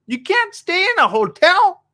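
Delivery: very high, slow falsetto; hammed-up squeaky outrage, drawn out; mock disbelief. Acted reaction to hotel closures.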